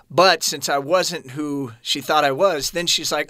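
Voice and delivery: ordinary talk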